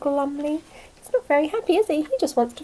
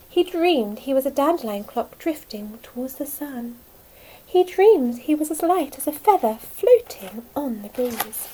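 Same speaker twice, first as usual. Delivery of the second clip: usual speaking voice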